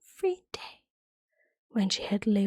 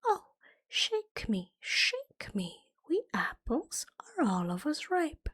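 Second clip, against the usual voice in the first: calling apple tree; 4 semitones higher, 4 dB quieter than usual. Acted. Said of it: "who called out".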